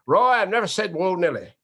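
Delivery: imitating an announcer's loud, high protest; mock-indignant announcer, starting high and loud, then falling; Keith Jackson. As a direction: object in a hammed-up sportscaster voice. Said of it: "he told me that on the show".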